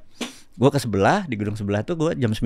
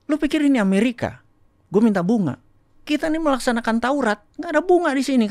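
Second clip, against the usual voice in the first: retold rebuke; 13 semitones higher than usual. Retelling the teller's rebuke.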